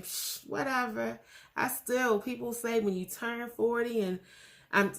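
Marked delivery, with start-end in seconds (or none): 0.0-1.2 dismissive scoff. Retold or imitated. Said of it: "I remember when I was thirty-nine thinking".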